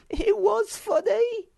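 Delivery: squeaky laugh through the words. Acted. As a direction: giggle it out, slow and high. Sniff laughing at it.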